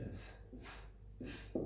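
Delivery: hissed s, s, s with no voice; isolated s sound. Shown as a demonstration. Teacher modelling the s sound for pupils. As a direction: say s as a bare unvoiced hiss. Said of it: "In that is some alliteration".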